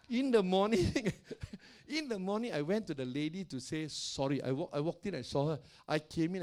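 ordinary talk, nothing marked

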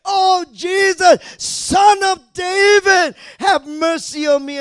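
high, slow, drawn-out shout; shouted plea. Acted. Blind man calling to Jesus for mercy, shouted plea. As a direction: cry out loudly over a crowd. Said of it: "and he shouted".

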